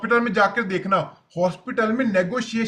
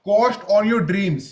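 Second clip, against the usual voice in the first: slow heading; 3 dB louder, much slower than usual. Shown as a demonstration. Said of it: "announcing next point heading".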